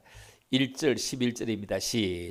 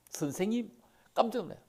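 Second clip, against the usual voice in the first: higher, startled "선생님"; startled cry; 6 semitones higher, 6 dB quieter, faster than usual. Acted. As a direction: gasp it out in surprise. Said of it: "하는 말". (예수를 알아본 마리아가 깜짝 놀라며)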